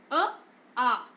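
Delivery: अ and आ sounded from the throat; vowel demo. Shown as a demonstration. Teacher demonstrates throat vowels to the class. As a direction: say अ, आ slowly from the throat. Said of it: "यह उच्चारण कहाँ से कर रहे हैं हम?".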